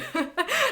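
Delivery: short high laugh at her own outrage; high burst of laughter. Real, not acted. Reviewer laughing mid-rant, high laugh. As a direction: let a laugh break out, high. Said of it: "Why am I getting so mad?".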